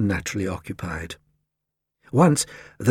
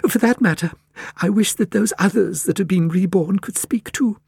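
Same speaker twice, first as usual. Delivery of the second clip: high-pitched lady thinking aloud; high, musing character voice; musing Cat Lady; 8 semitones higher than usual. A narrator's reading. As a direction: ponder wistfully in a high lady's voice. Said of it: "mused the Cat Lady".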